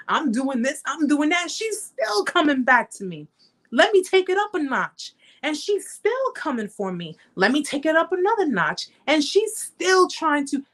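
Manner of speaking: imitated disbelief, climbing in pitch; incredulous abuser. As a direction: act out a bully amazed she keeps returning. Imagines the abuser marveling at her.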